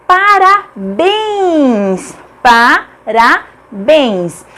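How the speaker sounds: "Parabéns" stretched out on a long béns, then split pa... ra... béns; slow demonstration of "parabéns", syllable by syllable; stressed last syllable. Shown as a demonstration. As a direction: draw out "Parabéns", then repeat it in separate syllables. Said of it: "A última sílaba é a tônica".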